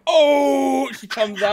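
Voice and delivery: hype announcer shout. Pretend. Wrestler entrance hype.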